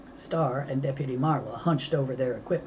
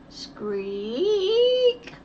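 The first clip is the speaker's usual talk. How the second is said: very high rusty-chain squeak; 4 dB louder than usual. A narrator's reading. Narrator imitating a swing heard down the phone.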